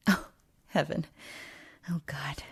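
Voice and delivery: hushed dramatic whisper within a monologue; hushed exclamation. Acted. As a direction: breathe it out under your breath, overcome. Overcome speaker in poem monologue.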